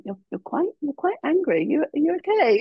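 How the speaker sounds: high, rising, worried questioning; concerned partner. Retold or imitated. Partner asking the angry tennis player, worried. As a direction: ask gently if she's okay. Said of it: "my partner was like".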